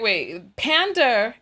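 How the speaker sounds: ordinary talk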